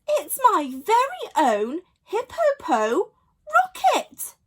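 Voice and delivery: laughing, then squeaking high on rocket; delighted hippo. A narrator's reading. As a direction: Sheila thanks friends, laughing with delight. Sheila thrilled at rocket.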